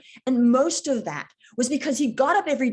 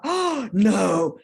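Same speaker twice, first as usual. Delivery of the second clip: mild mock alarm, gasped "oh"; a drawn-out, dreading reaction, acted out; retold dismay; 2 semitones lower, 4 dB louder than usual. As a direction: act out a sinking realization. Retold alarm on hearing bad news.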